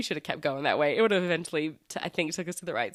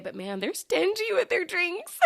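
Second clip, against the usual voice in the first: laughs through the words, pitched up; laughing complaint; 12 semitones higher than usual. Real, not acted. Laughing to viewers about stingy UK drinks. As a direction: giggle it out as a guilty confession.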